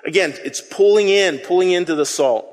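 normal talking voice

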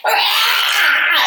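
sudden high yell of frustration, reenacted; retold frustrated scream. Mom reliving screaming at her child, high frustrated yell. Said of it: "I was just like".

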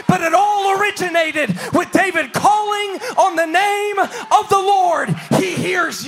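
loud rising preacher cadence; fiery sermon climax; preaching at full force. Acted. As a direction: preach it out to the congregation.

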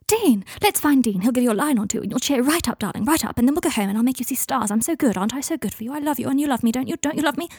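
high, breathless rush of promises; manic pleading. Acted. Draco begging Michael for love, manic and needy. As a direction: call out, then gabble into needy pleading.

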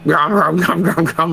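cartoonish munching; mock gobbling noises. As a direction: gobble noisily like a hungry kid. Imitates son gobbling food.

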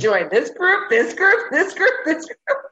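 high, loud and eager; pressing her to join, thrilled; excited urging. Retold or imitated. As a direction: insist with delight. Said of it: "And I was like".

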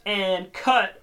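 high, drawn-out set call; mock director calling out; director's call. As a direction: sing it out across a film set. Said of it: "someone goes".

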